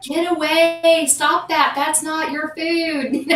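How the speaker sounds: high, slow scolding; retold yelling. Yelling at the raccoon from behind the door, high and slow. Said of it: "And I'm yelling at it".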